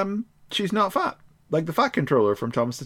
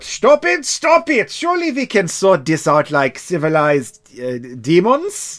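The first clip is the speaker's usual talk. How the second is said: put-on high, loud German-accented protest; 4 semitones higher, 4 dB louder than usual. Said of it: "that German accent".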